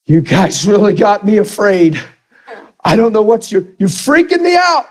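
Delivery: imitating a shaken man, high voice rising; really emotional, freaked out. Tim to the people who prayed for him, freaked out. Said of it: "he's really emotional".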